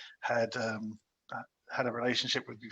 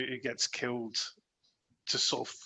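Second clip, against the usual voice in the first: ordinary delivery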